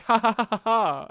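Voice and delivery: loud, deliberate fake laugh; written-out laughter. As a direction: read the laugh out as separate words. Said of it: "I just wrote, like".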